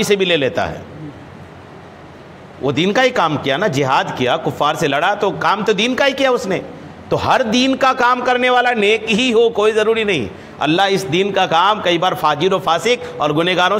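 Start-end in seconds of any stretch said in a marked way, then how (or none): none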